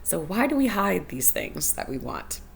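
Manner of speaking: usual speaking voice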